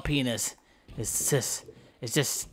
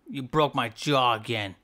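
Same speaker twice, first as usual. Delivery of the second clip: ordinary talk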